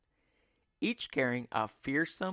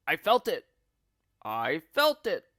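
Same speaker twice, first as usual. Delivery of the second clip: put-on louder angry old man; shouting; 3 semitones higher, 6 dB louder than usual. A narrator's reading. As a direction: bark it out in outrage. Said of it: "shouted Mister Twit".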